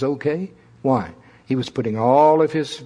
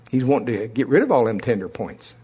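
ordinary talk, nothing marked

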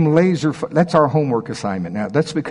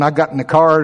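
ordinary talk, nothing marked

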